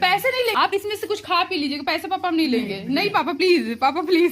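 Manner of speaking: high, loud insistent pleading; pleading refusal. Real, not acted. Daughter refuses money from father, high loud pleading. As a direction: beg him to keep his money.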